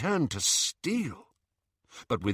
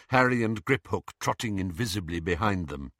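ordinary delivery, nothing marked

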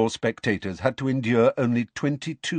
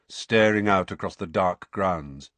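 usual speaking voice

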